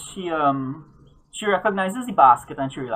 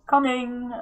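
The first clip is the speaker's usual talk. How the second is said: put-on old voice calling; 10 semitones higher than usual. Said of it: "you hear an old voice saying".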